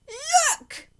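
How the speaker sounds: high, loud squeal of alarm; alarmed fish. A narrator's reading. The fish warning the children, high and shrill with alarm. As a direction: cry out like a panicking goldfish. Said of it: "Then our fish said".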